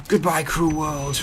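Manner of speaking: slow, high hammed-up farewell; mock dramatic farewell. Letting zombies down him in game, hammed-up farewell. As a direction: ham it up like a tragic hero.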